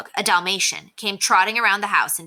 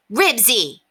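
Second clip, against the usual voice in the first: short, higher-pitched sharp call; 6 semitones higher, 4 dB louder than usual. A narrator's reading. Henry calls his dog off a stranger's Dalmatian, sharply. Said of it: "Henry spoke sharply".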